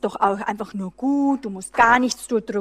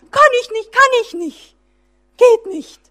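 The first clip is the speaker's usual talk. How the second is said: much higher, louder mock whimper of defeat; overwhelmed whine; 10 semitones higher, 9 dB louder than usual. Imitating the overwhelmed believer answering God's call: high, loud mock whine. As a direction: whine it like a child who has given up. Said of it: "als komplett Überforderung erlebt und sagt".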